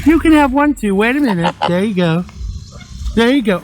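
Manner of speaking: high, coaxing voice to ducks; cooing at birds. Real, not acted. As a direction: coax the ducks gently. Talking to the ducks.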